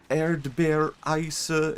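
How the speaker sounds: carefully rehearsed German order of "Erdbeereis"; careful German pronunciation. Retold or imitated. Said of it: "when I tried to order strawberry ice cream in German".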